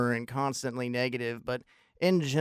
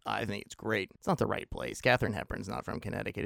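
ordinary delivery

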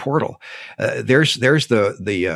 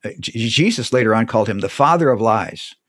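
normal talking voice

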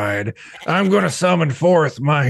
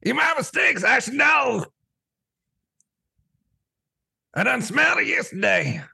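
much higher, shouted hillbilly taunt; human speaking voice; 12 semitones higher than usual. Acted. Goat taunts the farmer in a put-on human voice. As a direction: yell the insult like a yokel goat. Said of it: "my human speaking voice".

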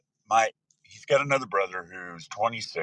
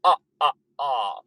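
slow, high vampire count voice; hammed-up Transylvanian announcer; Count Dracula; 6 semitones higher, 5 dB louder, slower than usual. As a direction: count it out like a cartoon vampire, laughing ah-ah-ah. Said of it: "I feel like Count Dracula".

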